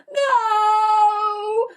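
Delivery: high, loud desperate no; a despairing cry. A narrator's reading. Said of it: "cried Edmund".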